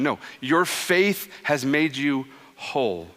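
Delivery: ordinary delivery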